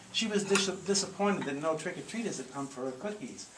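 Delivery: ordinary delivery, nothing marked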